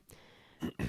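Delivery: unvoiced whisper. Real, not acted. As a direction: whisper it aside. Whispered aside.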